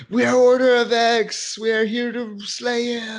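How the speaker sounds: loud, high-pitched mock band chant. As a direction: proclaim it like a hammed-up metal frontman.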